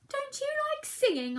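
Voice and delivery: high innocent mouse voice; innocent question. Acted. Mouse asking Bear about singing, innocent. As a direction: ask it puzzled and small. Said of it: "asked Mouse".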